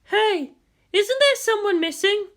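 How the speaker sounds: high curious voice. Acted. Makoto asks about someone.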